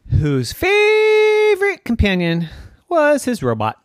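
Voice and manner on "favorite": FAVORITE stretched long, high and loud; drawn-out emphasis. A narrator's reading. Storyteller introducing a beloved robot companion, drawn-out emphasis. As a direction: hold and lift the word "favorite".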